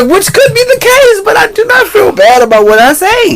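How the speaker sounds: squeaky, high defensive whine; high-pitched protest. Real, not acted. Protests laughingly, then gets mimicked.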